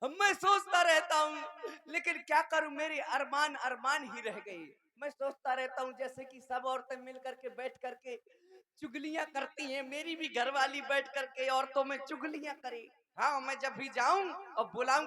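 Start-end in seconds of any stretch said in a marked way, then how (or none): none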